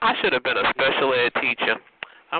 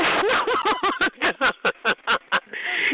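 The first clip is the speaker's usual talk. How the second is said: long, high real cackle; taunting cackle. Real, not acted. Host mocking a caller before the chat liners, high cackle. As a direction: laugh at length at the caller.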